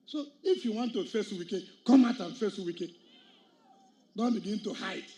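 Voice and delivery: normal talking voice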